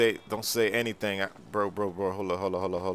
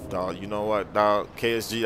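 ordinary talk

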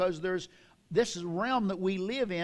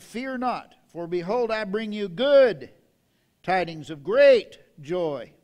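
loud, slow heavenly announcement; swelling proclamation of great joy; proclaiming angel; 7 dB louder, slower than usual. Acted. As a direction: ring it out across the whole room. Angel's message preached.

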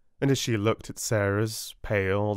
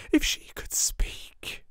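breathless whispered echo, slow; girl whispering to herself. A narrator's reading. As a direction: let the wish fade to a whisper. Sarah to herself, fading.

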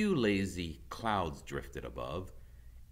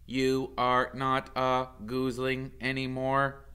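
a touch of a measured robot voice; lightly voiced robot mother; 8 semitones higher, 7 dB louder than usual. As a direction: speak evenly like a kind robot. Robot Roz to son.

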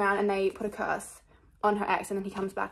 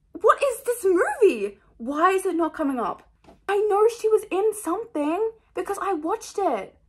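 loud, high-pitched frustration; exasperated; 8 semitones higher, 5 dB louder than usual. Real, not acted. Vlogger baffled by a fruitless movie search, exasperated. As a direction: raise pitch and volume in exasperation.

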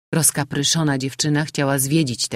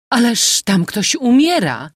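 higher, louder alarmed cry; exclaiming; 9 semitones higher, 7 dB louder than usual. A narrator's reading. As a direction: cry out in alarm at the dying woman. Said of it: "która wykrzyknęła".

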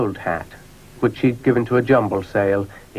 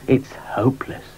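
whispering; 3 semitones higher than usual. Acted. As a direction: whisper it under your breath. Dougal gives up.

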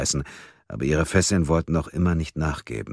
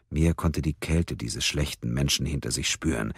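plain reading voice

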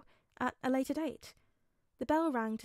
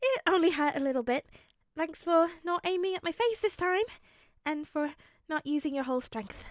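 higher-pitched, awkwardly light reassurance; 7 semitones higher, 5 dB louder than usual. A narrator's reading. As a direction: play down the hurt, a little awkward. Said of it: "Uraraka laughed awkwardly".

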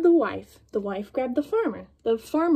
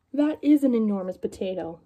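ordinary delivery, nothing marked

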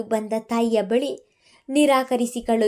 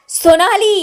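much higher and louder, a sharp shout; shouted name; 9 semitones higher, 10 dB louder than usual. Acted. Mithun shouting at Sonali to silence her, loud. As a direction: bark her name to stop her. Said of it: "ಎಂದು ಕೂಗಿದರು ಮಿಥುನ್".

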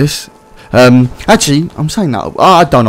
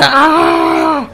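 high startled shriek; startled scream. Real, not acted. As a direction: yelp at a sudden fall. Scream at a fall.

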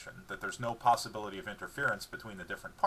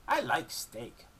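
slow dog voice, blissful; slow happy dog voice; 4 semitones higher, much slower than usual. A narrator's reading. Dog delighting in steak, slow happy dog voice. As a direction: savour the word steak.

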